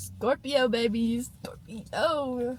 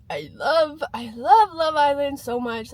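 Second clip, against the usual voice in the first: excited; 2 semitones higher, 8 dB louder, slower than usual. Real, not acted. Gushing about favourite show.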